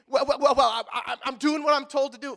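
loud, stammering mock defensiveness; put-upon husband protesting he's only obeying; flustered husband.

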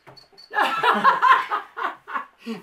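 loud, high burst of laughter; long real cackle, high and loud; laughing. Real, not acted. Laughs at the botched name.

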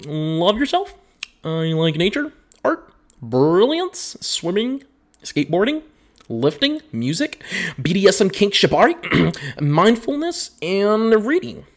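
each item lifted high with a rising end; mock-cheerful high list voice; high sing-song read. Shown as a demonstration. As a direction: read it like a bubbly list, every word going up. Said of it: "Let, let me read the bio again".

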